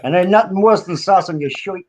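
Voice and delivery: fussy New York mobster customer. Pretend. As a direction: grumble the order in a New York accent. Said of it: "New York voice and go and buy".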